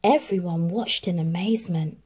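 plain reading voice

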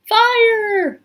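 high, loud drawn-out cry; alarm call. A narrator's reading. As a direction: raise the alarm, high and urgent. Scholar raises the alarm.

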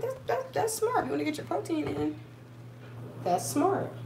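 mock impressed. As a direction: play it as exaggerated admiration. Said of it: "I'm like".

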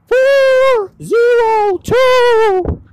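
high, stretched ghostly wail. Acted. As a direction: moan the numbers like a spooky ghost. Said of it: "I was trying to do a little ghostly voice".

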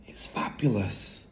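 hushed, voiceless wonder; awed whisper. A narrator's reading. Matilda admires her friend's parrot, whispering.

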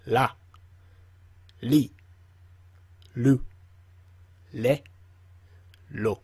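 la li lu le lo with a clear L; repeat after me. Shown as a demonstration. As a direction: model la, li, lu, le, lo slowly with an L for learners to copy. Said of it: "Repeat after me".

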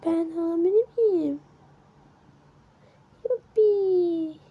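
high, loud, slow gasp into a gleeful yuppi; excited disbelief. Acted. Rose reading her pregnancy test, thrilled squeal. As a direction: burst out with joy at the news.

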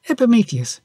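high-pitched, eager exclamation; exclaiming. A narrator's reading. Pandora turns to Epimetheus before opening the box, exclaiming. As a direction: call the name out, pitched well up. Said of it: "exclaimed Pandora".

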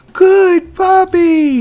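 put-on very high, loud doggy baby talk. Gamer praising puppy Code Red, very high baby talk. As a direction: coo over the puppy.

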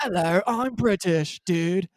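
slow, high mock British with surfer slip; badly faked British sliding into dude bro; hammed-up bro trying to sound British; dude-bro British accent. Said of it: "total dude bro accent going on".

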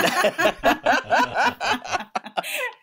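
real high-pitched laugh. Real, not acted. Host laughing at the dad being caught out. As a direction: laugh out at the story's punchline.

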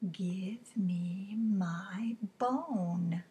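very slow spooky whisper; eerie whisper. Acted. The mysterious voice demanding its bone, whispered slowly. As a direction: draw it out like a ghost. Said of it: "a teeny tiny bit louder".

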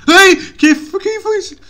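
high yelp dropping to a nervous question; jolted, voice jumping up; startled fright. Real, not acted. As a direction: react to a sudden noise. Startled by a sound in game.

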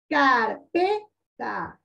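"Carpeta" very slowly in three beats, car-pe-ta; syllable by syllable. Shown as a demonstration. Teacher pointing out the weak syllable, "Carpeta" very slowly split. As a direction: stretch "Carpeta" out with pauses between syllables. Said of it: "miren, la ta".

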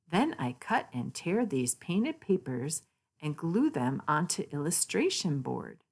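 ordinary delivery

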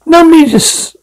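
squeaky high burst; sudden high-pitched eager start; excited. Acted.

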